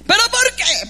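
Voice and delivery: high, loud bratty protest; hammed-up childish demand; mock tantrum. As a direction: shout it like an imitated tantruming child. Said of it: "un niño berrinchosos".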